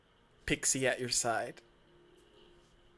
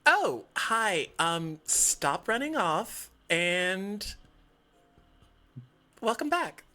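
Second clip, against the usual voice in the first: higher, bright pixie greeting; 6 semitones higher, slower than usual. Acted. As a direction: squeak it out, high and chirpy. Pixie greets returning friend.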